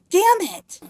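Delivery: high mock-angry squeal; frustrated curse. Queen overhearing the guard's news, high mock frustration. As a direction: curse in hammed-up exasperation. [name] acted cursing at bad news.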